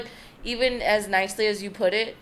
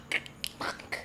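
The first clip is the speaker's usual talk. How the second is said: whispered curse; 14 dB quieter than usual. Retold or imitated. Muttering dread as solicitor approaches.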